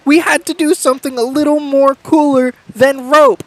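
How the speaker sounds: fed-up accomplice dropping the act; exasperated Ren. Acted. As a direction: throw up your hands and complain. DM voicing Ren's exasperated reveal.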